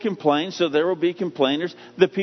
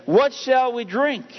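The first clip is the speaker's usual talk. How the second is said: whiny complaining people; 7 semitones higher, slower than usual. Acted. Israelites complain to Moses.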